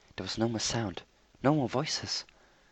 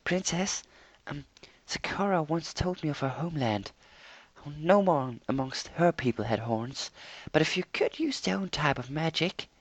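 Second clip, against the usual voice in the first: timid Fluttershy voice; 7 semitones higher than usual. A narrator's reading. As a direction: shyly offer an idea, voice raised high. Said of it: "Fluttershy piped up".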